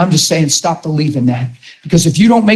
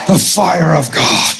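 fervent exclamation; slower than usual. Real, not acted. Exclaiming after preaching.

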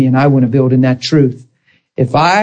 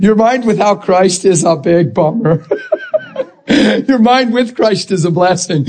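laughing; 4 semitones higher than usual. Real, not acted. Laughs at own big bummer joke.

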